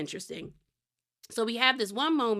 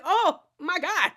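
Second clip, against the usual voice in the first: overwhelmed oh my God; 8 semitones higher, 3 dB louder, slower than usual. Retold or imitated. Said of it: "Like, I was just like".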